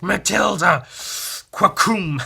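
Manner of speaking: loud high battle cry; shouting. Retold or imitated. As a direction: call out the names. Hero calls out in fight.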